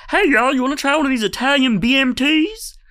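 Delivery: very high, loud cartoon alien voice; eager alien. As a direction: squeal out a friendly offer like an excited alien. Voices another alien with sandwiches.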